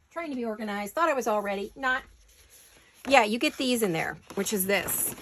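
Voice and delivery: ordinary talk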